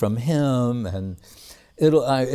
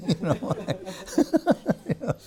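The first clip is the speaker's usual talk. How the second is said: laughing; 9 semitones higher, 5 dB quieter, much faster than usual. Real, not acted. Laughs at Krishna driving.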